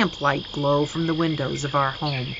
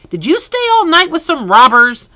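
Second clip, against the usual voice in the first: put-on much higher and louder, a little girl; piping little sister; 12 semitones higher, 7 dB louder than usual. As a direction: youngest sister pipes up eagerly. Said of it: "My youngest sister piped up".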